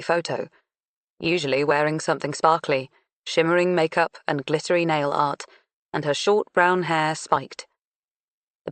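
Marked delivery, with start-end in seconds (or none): none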